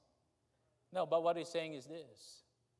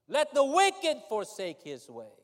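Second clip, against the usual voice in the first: preaching scripture; 7 semitones higher, 8 dB louder than usual. Acted.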